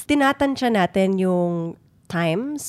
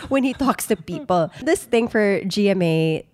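usual speaking voice

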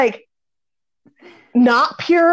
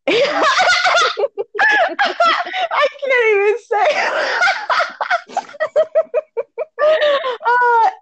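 high, breaking with laughter; can barely get words out for laughing; laughing to tears; 13 semitones higher, slower than usual. Real, not acted. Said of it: "I'm crying over here".

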